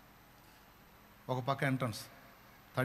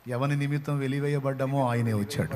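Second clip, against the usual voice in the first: rising to a shouted preaching peak, higher and louder; preaching at full force; 5 semitones higher, 17 dB louder than usual. Acted. Preacher proclaims to the congregation that Jesus lives, full-force shout. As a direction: build to a roaring altar-call climax.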